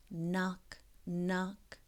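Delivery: ordinary delivery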